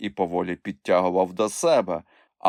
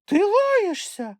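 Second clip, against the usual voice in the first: very high, slow squeaky astonishment; gasp-free squeak of shocked reproach; stunned; slower than usual. A narrator's reading. Chmykh hears Moomintroll swear, squeaky and stunned. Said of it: "отетерів Чмих".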